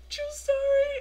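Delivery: high, squeaky little reply; tearful squeak. Retold or imitated. Fan with a tear running down, high squeak.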